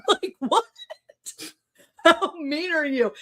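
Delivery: high, laughing disbelief; incredulous laughter. Real, not acted. To the commenters, laughing in disbelief.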